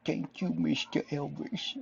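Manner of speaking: lightly voiced slow [name] drawl; a hint of a crooning [name] thank-you; [name] impression. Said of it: "do an [name] impression voice".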